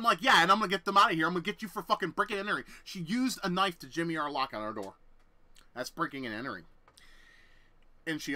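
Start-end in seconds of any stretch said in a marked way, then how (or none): 0.2-2.6 loud, rapid threat; fast angry counter. Retold or imitated. Yelling back at her about breaking and entering, fast.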